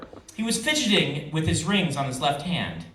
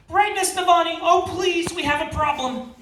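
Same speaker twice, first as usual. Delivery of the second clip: much higher, urgent servant's plea; frantic servant calling; 12 semitones higher than usual. Acted. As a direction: call out anxiously from the kitchens.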